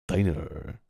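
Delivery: "Diner" with an American er ending; American diner demo. Shown as a demonstration. Streamer demonstrating "Diner" for chat. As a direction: say "Diner" with the r curled at the end. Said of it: "it's pronounced diner".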